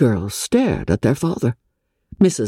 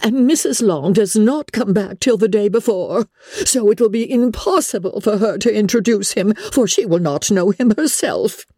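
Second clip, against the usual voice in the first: high, agitated mother voice; crying out; 10 semitones higher than usual. A narrator's reading. Mother realising the introduction is impossible, crying out. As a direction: lament it at a sustained high pitch. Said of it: "cried her mother".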